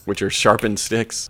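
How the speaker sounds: ordinary talk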